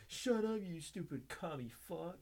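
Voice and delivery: high-pitched mock outrage; imitating a riled gamer; angry comeback. Acted opponent snapping back at Russian.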